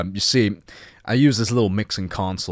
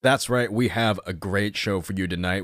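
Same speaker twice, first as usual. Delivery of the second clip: normal talking voice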